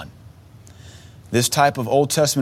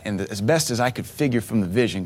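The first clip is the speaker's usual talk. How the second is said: normal talking voice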